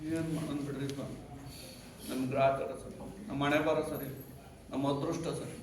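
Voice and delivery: soft, low, resigned sigh of a lament; sad lament. Acted. Storyteller voices the despairing brahmin, soft and sad. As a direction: give up on fate, barely above a murmur.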